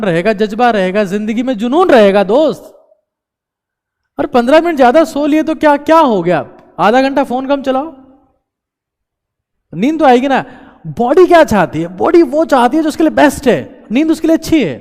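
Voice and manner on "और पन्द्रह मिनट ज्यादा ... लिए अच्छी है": fired-up urging. Real, not acted. Urging viewers to sleep more.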